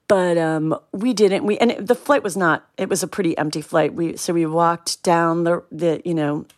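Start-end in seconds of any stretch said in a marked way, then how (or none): none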